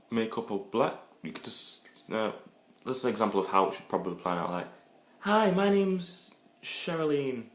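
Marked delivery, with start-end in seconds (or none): 5.2-7.4 put-on high, slow girly voice; woman introducing herself at the club, high and slow; girly introduction. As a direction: play a woman drawing out her name.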